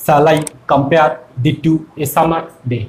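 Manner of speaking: shall I compare thee to a summer day, low and slow in pairs of beats; slow heartbeat pulse through "shall I compare thee to a summer day"; iambic scansion. Shown as a demonstration. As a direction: scan "shall I compare thee to a summer day" foot by foot, pausing between feet. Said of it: "ছন্দে এই কবিতা চলবে".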